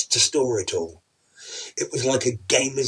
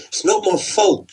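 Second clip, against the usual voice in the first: defensive whine; 9 semitones higher, 6 dB louder than usual. Acted. Husband defends himself.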